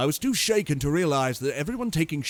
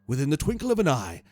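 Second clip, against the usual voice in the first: ordinary delivery, nothing marked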